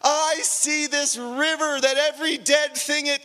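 high, drawn-out exclamations of wonder; marvelling praise. Acted. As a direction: proclaim it in awe, stretching the words. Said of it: "he would marvel and give praise to God".

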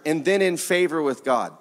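normal talking voice

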